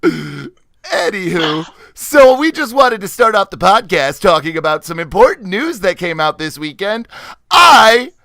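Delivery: showy podcast host with punched words; milk the big news like a pompous announcer; hammed-up announcer. Host presenting the weekend's important news, hammed-up announcer.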